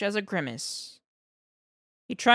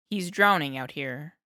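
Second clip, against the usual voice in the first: usual speaking voice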